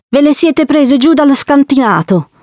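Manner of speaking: voce acuta di bambina decisa; bambina che accusa. A narrator's reading. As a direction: accusa l'adulto con voce alta e infantile. Lila accusa Don Achille. (bambina accusatrice, tono acuto)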